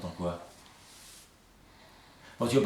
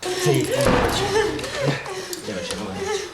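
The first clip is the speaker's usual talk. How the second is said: high excited laughing; 4 dB louder than usual. Acted.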